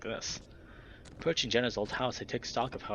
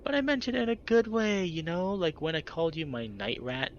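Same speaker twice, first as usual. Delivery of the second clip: put-on much higher and louder, dopey girlish whine; childish whine; 7 semitones higher, 3 dB louder than usual. A narrator's reading. Heather defending her slur to friends, dopey childish whine. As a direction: plead it like a silly child. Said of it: "dopey, childish undertone".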